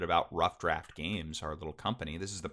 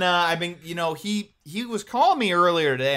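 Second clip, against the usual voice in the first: ordinary talk, nothing marked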